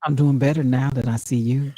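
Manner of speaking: smooth talking guy; man voice. Pretend. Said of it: "man voice so good".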